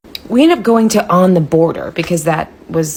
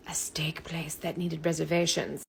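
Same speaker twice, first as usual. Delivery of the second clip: hushed, breathy storytelling; whispered confession to viewers; whispered aside; 17 dB quieter than usual. Acted. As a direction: let them in on a secret.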